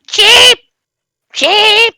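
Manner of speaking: very high, loud shrieking cheeps; peeping baby chick. Acted. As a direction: squeal each cheep up high. Giant chick cheeps at Marcus.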